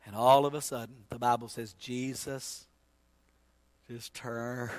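low, soft, suspenseful telling; hushed preaching. Acted. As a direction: drop to a hush after the climax. Hushed telling after the crow.

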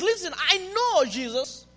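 a high, begging plea; pleading woman. Retold or imitated. Woman begging Jesus for mercy, lightly voiced. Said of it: "this woman says".

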